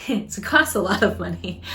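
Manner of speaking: chuckling at your own wordplay; laughing. Real, not acted. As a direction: laugh while making the little joke. Laughs at her own name joke.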